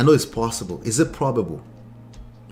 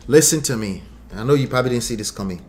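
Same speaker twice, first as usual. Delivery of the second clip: ordinary delivery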